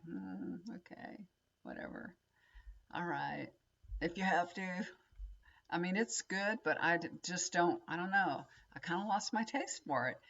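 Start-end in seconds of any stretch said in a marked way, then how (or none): 0.1-4.9 soft, unenthusiastic giving-in; grudging, hushed "whatever" acceptance; reluctant agreement. Retold or imitated. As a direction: go along with it half-heartedly, under your breath. Acting her reluctant reply to husband.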